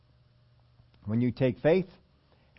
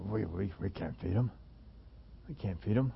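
soft, lower, flustered we-can't; stammering disciples; 4 semitones lower, 8 dB quieter than usual. Retold or imitated. Mock panicked disciples, softer. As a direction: disciples telling Jesus they can't feed the crowd, flustered and soft.

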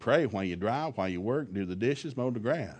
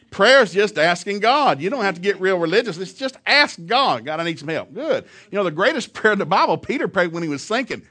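full-force preaching; 6 semitones higher, 8 dB louder than usual. Acted. Preacher rousing his church, loud and high.

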